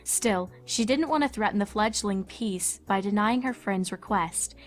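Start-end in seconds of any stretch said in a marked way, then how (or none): none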